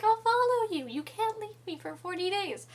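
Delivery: high, loud desperate plea; cling to him in a squeaky voice; clingy Fosca. Retold or imitated.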